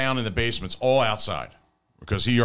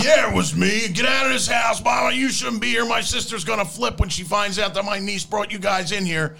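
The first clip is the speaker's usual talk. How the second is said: high, angry hollering impression; small man's biker voice; 12 semitones higher than usual. Uncle throwing seven guys out of the house, angry shout. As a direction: rant at them in the raised biker yell. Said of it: "But he just had the voice".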